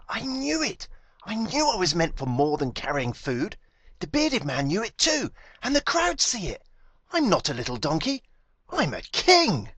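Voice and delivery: high, loud triumphant cry; donkey thrilled by the cheering crowd, triumphant and loud; exultant. A narrator's reading. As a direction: burst out in triumph, voice soaring.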